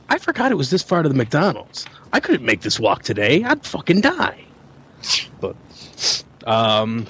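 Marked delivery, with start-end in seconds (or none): 0.1-4.4 mock-whiny, rising to a much higher squeal at the end; exasperated adult. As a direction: play up the disbelief, peaking high. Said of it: "I was like".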